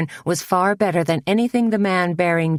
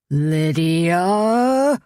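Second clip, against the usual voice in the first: bellowed name; 2 semitones higher than usual. A narrator's reading. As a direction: bellow her name from afar. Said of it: "bellowed Klaus Elam".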